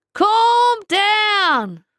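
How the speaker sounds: high loud commanding yell. A narrator's reading. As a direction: shout to stop her panic. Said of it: "yelled Grimacy".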